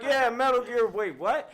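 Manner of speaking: surprised double take. Retold or imitated. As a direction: catch it late, then baffled. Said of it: "I was like".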